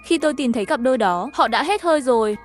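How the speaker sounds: plain reading voice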